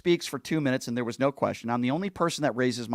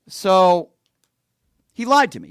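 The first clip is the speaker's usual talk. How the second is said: louder, higher burst of outrage; loud outburst; 5 semitones higher, 10 dB louder, slower than usual. Real, not acted. Venting to viewers that he was lied to, loud outburst. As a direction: spit it out loud and high, indignant. Angry rant about being lied to.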